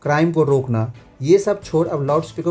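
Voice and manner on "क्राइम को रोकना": ordinary delivery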